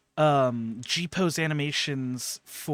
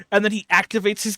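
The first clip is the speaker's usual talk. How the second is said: ordinary delivery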